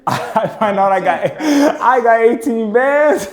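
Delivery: bragging to the host about a new card, hyped; hyped-up excitement. Retold or imitated. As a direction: celebrate like you just hit the jackpot. Gloating over big credit.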